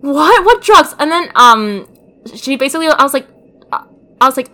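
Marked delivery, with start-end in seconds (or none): 0.0-1.0 high, loud mock shock; alarmed mom. Mom reacting to hearing about drugs, in hammed-up shock. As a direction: squeal it as a startled mom.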